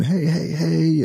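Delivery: high drawn-out mock yell of hey; imitating drunk men yelling at him; retold screaming. Drunk men yelling at his daughter in the taxi, mock yell. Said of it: "I hear this screaming".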